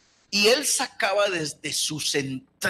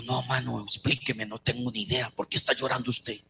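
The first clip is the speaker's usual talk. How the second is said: low, softer wondering voice; hushed puzzled question; 7 semitones lower, 8 dB quieter than usual. Retold or imitated. A puzzled fellow pastor asks softly why he is crying. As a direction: ask it gently under the breath.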